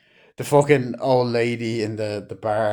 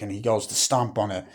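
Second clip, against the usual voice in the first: ordinary talk, nothing marked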